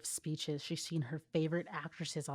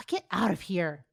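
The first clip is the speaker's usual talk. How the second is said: mock bossy order; authoritative bark; 2 semitones higher, 6 dB louder than usual. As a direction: order him out like a best friend. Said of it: "Ino's authoritative bark".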